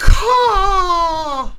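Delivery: high, loud chirping bird call; loud bird squawk. Pretend.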